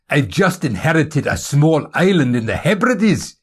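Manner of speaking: high, excited Scottish announcement. Acted. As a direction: burst out with good news in a Scottish accent. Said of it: "he said with excitement".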